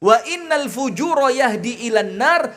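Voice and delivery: pembacaan hadis lambat dan berirama; hadith recitation. Acted. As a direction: lantunkan hadis dengan khidmat. (ustadz membacakan hadis kepada jamaah, melantun pelan)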